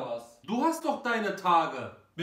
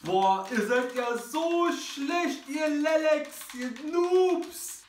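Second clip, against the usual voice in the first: trash talk; 7 semitones higher, slower than usual. Acted. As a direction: taunt them like a sore winner. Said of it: "Was ist los mit dem?".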